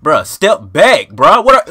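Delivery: slow, loud exasperated yell; frustrated yell. Real, not acted. Gamer yelling at his opponent to step back, loud and slow. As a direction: bark it out, fed up with him.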